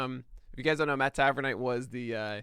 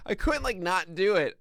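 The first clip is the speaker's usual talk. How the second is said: high voice just out of a laugh; pitched-up, tickled admission; amused; 6 semitones higher, 5 dB louder than usual. Real, not acted. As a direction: confess it while recovering from giggles. Amused apology straight after laughing.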